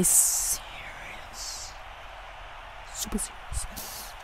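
whispered to himself. Real, not acted. Gamer psyching himself up mid match, whispered. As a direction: hush it out as you focus in.

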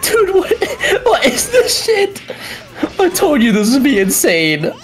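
high, cracking laugh-yell of disbelief; real shocked laugh-yell. Real, not acted. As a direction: freak out at what you just saw. Disbelief at the play.

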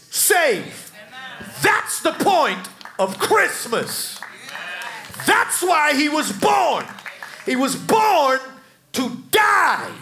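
loud, high, slow-building sermon cries; preaching at full force. Acted.

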